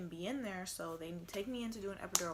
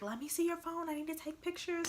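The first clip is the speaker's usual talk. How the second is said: higher, bright lightly voiced nurse; chirpy nurse asking; 9 semitones higher, 4 dB louder than usual. Nurse asking the mom for her phone, lightly voiced.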